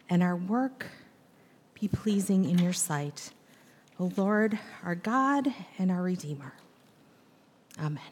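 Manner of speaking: low, soft prayer voice with pauses; hushed, measured opening prayer. Real, not acted. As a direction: pray quietly and reverently, phrase by phrase. Leads the congregation in prayer.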